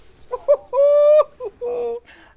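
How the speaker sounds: high, loud hooting whoop; gleeful hoot, held high; triumphant whoop. Real, not acted. Streamer gloating after countering opponent, high triumphant whoop. Celebrating a winning spell counter.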